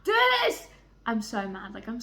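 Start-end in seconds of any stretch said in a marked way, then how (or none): 0.0-0.7 loud, high exasperated cry; real exasperation, loud and high; frustrated outburst. Real, not acted. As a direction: throw up your hands in fake despair. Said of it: "I'm mad".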